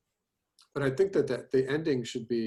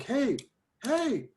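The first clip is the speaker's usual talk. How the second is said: calling out; 12 semitones higher than usual. Shown as a demonstration. Teacher acting the station master calling out. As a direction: call out to get attention. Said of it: "if you could, like".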